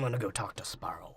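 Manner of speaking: sneaky whisper; whispered aside. Retold or imitated. Podcaster voicing Barbossa walking off, whispered aside. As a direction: whisper it while slinking off. Whispered mock exit line.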